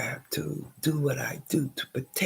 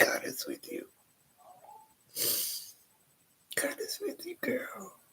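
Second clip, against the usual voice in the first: soft whispered reassurance; self-comfort; 9 semitones higher, 9 dB quieter than usual. Real, not acted. Comforting herself after coughing, soft whisper. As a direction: tell yourself gently it will be fine.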